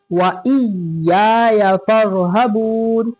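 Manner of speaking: व इय्याया फरहबून with the doubled y in इय्याया pressed hard and held. Shown as a demonstration. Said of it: "देखें आप लोग इसको कैसे पढ़ेंगे".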